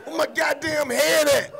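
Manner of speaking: mock frantic yell. Blind man searching, acted.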